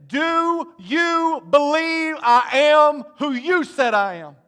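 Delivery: Jesus challenging Martha's faith, loud and slow; passionate challenge. Acted. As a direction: preach it at full force, stretching the words. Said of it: "This is what he's saying".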